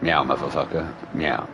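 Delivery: half-hearted imitated meow; lazy meow. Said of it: "Too lazy to talk".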